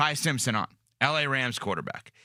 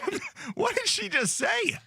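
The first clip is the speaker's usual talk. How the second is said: laughing. Real, not acted. Laughing at friend's pronunciation.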